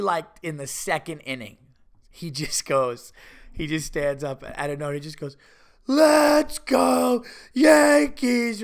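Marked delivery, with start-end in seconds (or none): 5.9-8.6 long, high, loud stadium holler; drawn-out drunken cheer at full volume; drunk fan shout. Retold or imitated. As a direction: bellow it like a hammered ballpark fan. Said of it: "there's this one drunk dude".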